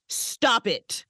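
ordinary talk

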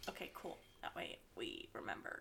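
low whispered aside. Real, not acted. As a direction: murmur it under breath. Unvoiced trailing lines.

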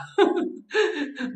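high burst of laughter; laughing at a joke about heaven. Real, not acted. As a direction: crack up at own joke.

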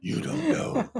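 hushed gravelly Batman line; whispered Batman catchphrase. Pretend.

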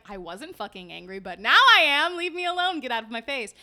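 loud furious snap; angry. Retold or imitated. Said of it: "then I'll be like".

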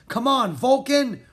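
loud high urging push. Acted. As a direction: cheer the dragon on hard. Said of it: "Rory urged him".

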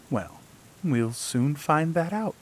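a hint of a knowing old queen, slow. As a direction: lightly voice the scheming queen, slowly. The old queen voiced in story.

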